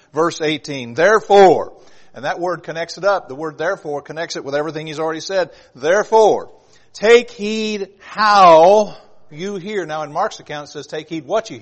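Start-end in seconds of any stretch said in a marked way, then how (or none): 1.0-1.7 "Therefore" loud and higher; emphatic THEREFORE. Acted. Preacher announcing verse eighteen, emphatic "Therefore". Stresses the connecting word of verse. 5.8-9.9 loud, high and very slow, "how" drawn out; emphatic HOW. Acted. Preacher driving verse eighteen home, drawn-out stress on "how".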